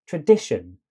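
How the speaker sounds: "tradition" with a loud middle syllable; center stressed. Shown as a demonstration. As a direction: punch DI in "tradition", keep the edges light. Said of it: "stress the center".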